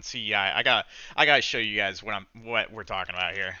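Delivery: ordinary talk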